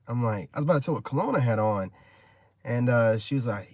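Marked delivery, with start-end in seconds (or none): none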